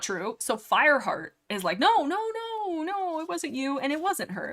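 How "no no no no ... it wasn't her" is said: pleading reassurance. Acted. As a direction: beg her to stop blaming herself. Reading Fireheart comforting Cinderpaw.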